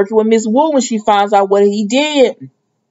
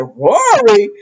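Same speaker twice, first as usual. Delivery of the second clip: mock indignant surprise; 12 semitones higher than usual. [name] jealous at first name.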